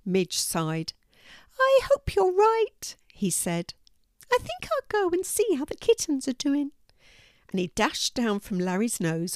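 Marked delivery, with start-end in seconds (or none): 1.6-2.9 high, hopeful squeak; high-pitched squeaky mouse; little mouse character. A narrator's reading. Narrator voices the mouse. 4.3-6.7 high, squeaky mouse voice; high-pitched eager mouse; little mouse character. A narrator's reading.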